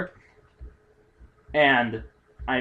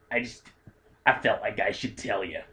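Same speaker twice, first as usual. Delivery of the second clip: put-on old lady voice; 5 semitones lower, 5 dB quieter than usual. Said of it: "our old ladies sound almost exactly alike".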